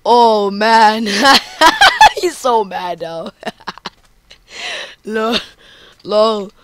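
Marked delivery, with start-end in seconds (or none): 1.1-2.1 a burst of high laughter; high cackling laugh. Real, not acted. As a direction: crack up at his rage. Laughing at mad opponent.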